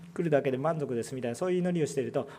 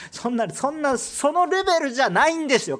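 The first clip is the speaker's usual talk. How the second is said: high, loud preaching push; loud emphatic protest; 8 semitones higher, 7 dB louder than usual. Acted. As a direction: rise up and drive the point home. Preacher heats up in rebuttal.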